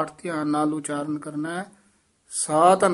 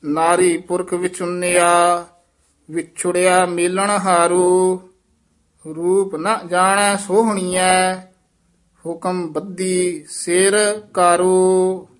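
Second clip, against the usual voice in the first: measured, sustained Gurbani recitation; scripture recitation; 2 semitones higher, 6 dB louder, much slower than usual. Shown as a demonstration. Teacher reciting verse for students, sustained recitation. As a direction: hold the line endings long and even.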